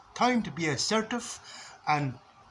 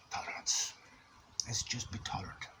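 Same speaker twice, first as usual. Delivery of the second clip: sigh, then much softer breathy tolerance; hushed self-calming; 6 semitones lower, 10 dB quieter than usual. Pretend. Lying in bed calming himself about flatmate, hushed. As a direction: talk himself down, almost under breath.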